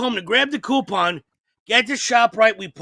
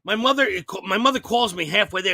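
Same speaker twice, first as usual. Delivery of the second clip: normal talking voice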